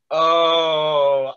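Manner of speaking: mock moment of seeing through it; knowing realisation. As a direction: stretch it out as if it all clicks. Acting out sceptical sudden realisation.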